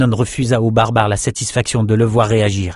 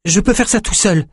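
put-on young swordsman voice; 12 semitones higher than usual. A narrator's reading. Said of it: "dit Bannon".

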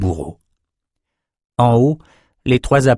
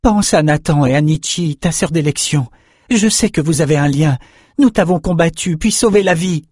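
higher-pitched, insistent appeal; urgent plea; 7 semitones higher than usual. A narrator's reading. Young swordsman reminding the panther of their bond, pleading. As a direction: plead with the beast to remember.

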